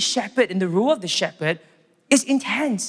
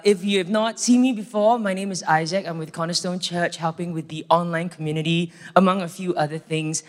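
usual speaking voice

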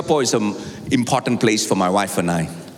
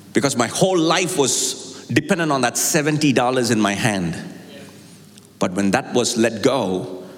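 ordinary delivery

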